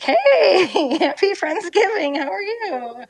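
very high, loud sing-song greeting; hostess with the mostest. Retold or imitated. Greeting arriving guests, high loud hostess cheer. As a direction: gush a welcome like the perfect hostess. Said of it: "I'm hostess with the mostest".

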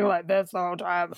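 mock tough-guy deep voice; ridiculously deep voice. Co-host mocking an actor's constant Rambo voice, ridiculously deep. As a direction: put on a silly deep Rambo-style voice. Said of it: "ridiculously deep voice".

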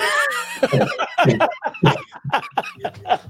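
loud, high burst of real laughter; big laugh. Real, not acted. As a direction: crack up hard at the punchline. Laughing at own story.

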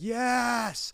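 high, drawn-out cheer; choir cheer. Retold or imitated. Choir cheering as singers start, high drawn-out cheer. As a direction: imitate a crowd's rapturous yes.

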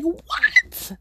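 very high, disbelieving yelp; hammed-up retold shock; incredulous. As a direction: squeak it out in utter disbelief. Retold shock at neighbor's explosion.

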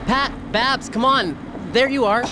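high, eager call across the street; calling out excitedly. Acted. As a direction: shout to them with relief. Spots sisters, calls to bus.

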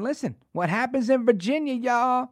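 high, hammed-up mock of a politician; Kamala Harris. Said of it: "clip of her saying".

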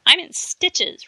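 slow, high, loud mock amusement; dry sarcasm. A narrator's reading. Cindy mocks the trapped boy, slow sarcastic drawl. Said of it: "replied Cindy".

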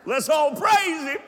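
high, loud call to the crowd; praise shout. Real, not acted. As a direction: shout it out to rally the room. Calls congregation to praise.

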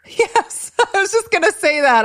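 high, giggly burst of agreement; laughing agreement. Real, not acted. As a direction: laugh out a delighted yes. Laughing agreement, same thought.

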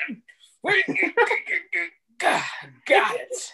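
high, strained grunts while struggling; mock straining man. Friend mimics the husband straining to open nail polish. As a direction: pretend to fight a stuck lid.

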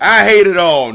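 loud, high declaration; loud rant. Acted. Ranting at the table, shouting. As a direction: belt it like a tantrum.

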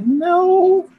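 shrill scared squeak, very high; squeaky high no. Retold or imitated. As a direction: squeak it out, terrified. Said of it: "I was like".

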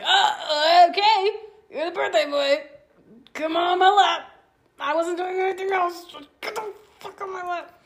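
high, loud, gushing voice for the cat; pet-talk voice to cat. Retold or imitated. Owner coos at her cat on his birthday, high and loud. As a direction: coo at the birthday cat, then swear fondly. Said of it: "He didn't care how I was talking about it".

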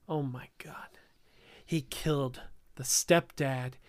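stunned hushed whisper. Pretend. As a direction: gasp it under your breath. Shocked at the story's twist.

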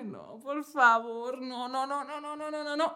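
soft, drawn-out plea trailing into repeated no's; mock pleading. Daughter dreading seeing grandmother for three days, softly re-enacted pleading. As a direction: beg weakly, quietly repeating the refusal. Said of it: "me tiré tres días en plan de".

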